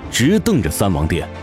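ordinary talk

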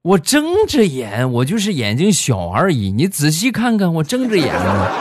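high-pitched, exasperated self-defence, first words stretched; worked-up protest. Retold or imitated. Small-eyed passenger insisting he is awake, flustered protest. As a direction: protest indignantly, getting flustered. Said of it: "说话可能着急了一点".

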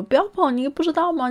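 a small leaf shrieking to stop the orange; high, loud panicked warning; alarmed cry. Acted.

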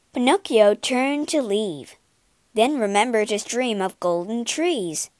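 ordinary delivery

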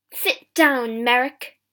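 loud shopkeeper's command; forceful command to an underling; barked order. A narrator's reading. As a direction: order him sharply, at volume. Shopkeeper orders the creature about.

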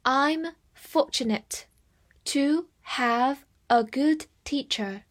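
slow, word-by-word model reading. Shown as a demonstration. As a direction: read it slowly with gaps between phrases. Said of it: "我们慢慢来读". (慢慢地逐词朗读)

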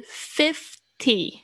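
"fifty" slowly split into "fif" and "ty"; sounded out. Shown as a demonstration. Teacher contrasting it with fifteen, slow syllable-by-syllable "fifty". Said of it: "我把它拼出来".

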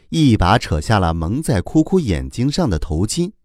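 ordinary delivery, nothing marked

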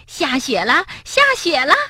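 high, joyful cheer. Acted. Rabbit cheering as he runs into the snow. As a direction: cry out with delight at the snow. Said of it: "蹦蹦欢呼着".

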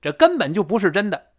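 根本 punched high and loud; emphatic 根本. Shown as a demonstration. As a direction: hit 根本就 hard. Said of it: "加强语气了吧".

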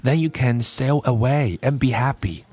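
measured storybook reading with lilting pitch; story read-aloud. A narrator's reading. Teacher reading a story for listening practice, storytelling. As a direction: read it like a gentle picture-book tale.

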